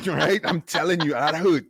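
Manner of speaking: loud, laughing teasing warning; laughing tease. Real, not acted. To Jen about hitting your head on a plane, laughing. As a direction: tease her through laughter.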